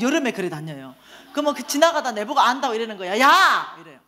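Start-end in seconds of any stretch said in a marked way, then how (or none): 3.2-3.7 loud, raised hail; mock street call. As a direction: holler to someone passing by. Homeless acquaintance calling out to her.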